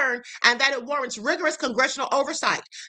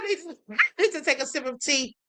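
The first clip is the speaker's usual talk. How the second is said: ordinary delivery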